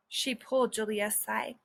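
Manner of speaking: plain reading voice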